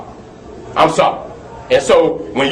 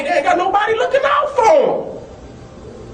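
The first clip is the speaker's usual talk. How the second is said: impassioned shout; 12 semitones higher than usual. Real, not acted. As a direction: cry it out high, full of anger. Repeats line, first much higher.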